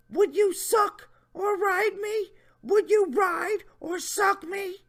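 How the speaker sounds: high mock leer; Jeff Stacy voice. Reading the predator's question, high mocking voice. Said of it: "my Jeff Stacy voice".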